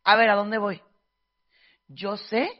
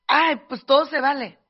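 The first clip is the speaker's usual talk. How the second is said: mocking carefree voice; 4 semitones higher, 8 dB louder than usual. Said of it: "como que".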